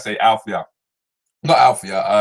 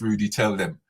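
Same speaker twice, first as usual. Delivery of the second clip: normal talking voice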